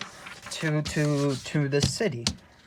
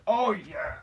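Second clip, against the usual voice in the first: higher, held-back realisation; mock remembering; 6 semitones higher than usual. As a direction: let it dawn on you, pitched up. First take of elf's sudden remembering.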